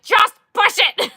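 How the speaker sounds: high, loud, slow mock yell at the groom; retold yell. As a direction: shout it like the ring is stuck mid-ceremony. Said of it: "And I was like".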